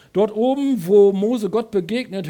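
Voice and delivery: loud, high, rising preacher's call; preaching at full force. Acted. Preacher booming to the congregation, loud and high. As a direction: lift the congregation to the mountain.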